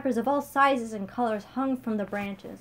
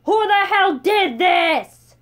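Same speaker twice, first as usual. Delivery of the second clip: a much higher, much louder and slowed roar; furious scream; 10 semitones higher, 13 dB louder, much slower than usual. Acted. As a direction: bellow it across the kitchen in rage. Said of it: "Chancellor George screaming".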